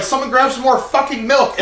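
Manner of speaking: high-pitched mock desperation; urgent retold demand. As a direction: reenact a frantic call for help. Retells urgent demand for milk.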